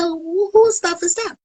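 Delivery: high, sharp, disbelieving question; shocked question. Retold or imitated. Woman confronting her friend, high and shocked. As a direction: demand to know whose stuff it is.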